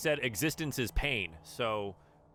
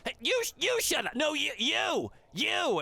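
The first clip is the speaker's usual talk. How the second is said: flustered madcap; 4 dB louder than usual. Acted. Madcap fumbling a comeback.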